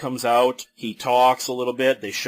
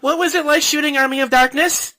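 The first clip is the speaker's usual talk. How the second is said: dumb fan question; 13 semitones higher, 6 dB louder than usual. Retold or imitated. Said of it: "some dumb question like".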